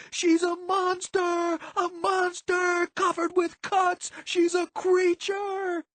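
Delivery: slow, piercingly high screamed accusation; screaming. A narrator's reading. Violet pointing at Quendy, screaming. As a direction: scream it, dragging out each word. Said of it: "Violet was screaming".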